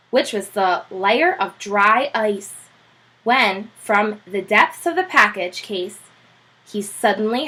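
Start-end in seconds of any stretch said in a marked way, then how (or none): none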